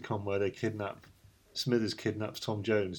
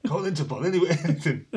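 laughing through the words; 8 semitones higher, 4 dB louder, faster than usual. Retold or imitated. Retold quote dissolving into laughter.

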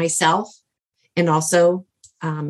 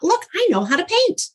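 put-on high, eager voice of the young man; young man eagerly telling her he can paint, excited; 11 semitones higher than usual. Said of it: "He goes".

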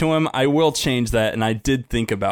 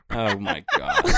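breaking into laughter; can't stop laughing; slower than usual. Real, not acted. Cracking up at sound effects.

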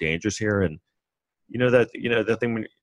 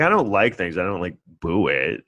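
normal talking voice

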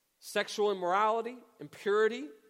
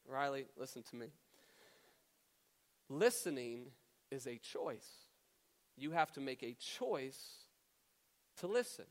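low, soft, deliberate parental talk; calm firm father; 5 semitones lower, 6 dB quieter than usual. Retold or imitated. Dad counseling his upset daughter at bedtime, calm and firm.

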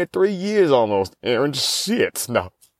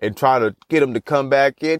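usual speaking voice

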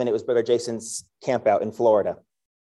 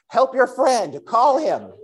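yelling at birds; 10 semitones higher, slower than usual. Retold or imitated. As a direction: shout an order at birds on the patio. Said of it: "and I yell".

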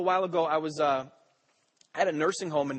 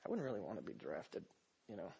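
much lower, much softer private thought; inner thought; 7 semitones lower, 15 dB quieter than usual. Retold or imitated. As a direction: confess it half under your breath. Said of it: "I'm thinking to myself".

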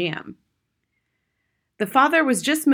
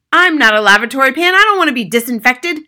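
loud, indignant protest; husband crying out; 6 semitones higher, 12 dB louder than usual. A narrator's reading. Husband refuses to be disinfected, crying out. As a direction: protest loudly, voice raised. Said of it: "the husband cried".

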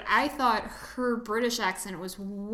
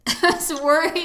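laughing; 6 semitones higher, 8 dB louder than usual. Real, not acted.

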